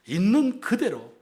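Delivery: loud, slow 있는 그대로; emphatic. Real, not acted. Pastor urging the congregation, loud and slow. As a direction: lean hard on 있는 그대로.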